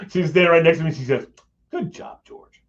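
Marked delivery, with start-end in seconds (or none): 1.7-2.6 whispered to herself. Retold or imitated. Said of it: "like that".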